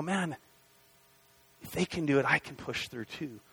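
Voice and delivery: hushed inner pep talk from watching others; quiet self-encouragement. Retold or imitated. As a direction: talk yourself into pushing on, under your breath. Quiet resolve inside a group.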